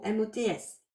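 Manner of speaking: "M O T S" said letter by letter; spelled out. Shown as a demonstration. Clarifying the word for her students, she spells it out letter by letter. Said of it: "Qu'est-ce que je ressens comme mot?".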